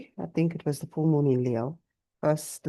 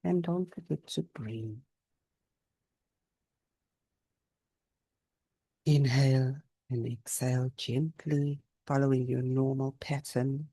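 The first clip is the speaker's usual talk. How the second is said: unhurried yoga nidra voice with long pauses; guided meditation. Real, not acted. Teacher guiding listeners to notice body and breath, calm meditation. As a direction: guide them gently through the body, leaving space after each phrase.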